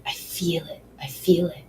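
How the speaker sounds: soft whispered affirmation. Shown as a demonstration. Guide whispering the affirmation for the listener, whisper. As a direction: let the words fade into a whisper. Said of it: "we're also going to breathe into".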